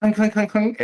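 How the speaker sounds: high, quick metallic clinks; clinking metal imitation. Game master sounds out a knight running, clinking imitation. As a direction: patter out high little clinks.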